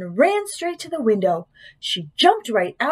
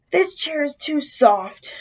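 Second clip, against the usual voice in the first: slow, higher complaint from a sinking seat; struggling Goldilocks; 5 semitones higher, slower than usual. A narrator's reading. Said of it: "struggling to get out".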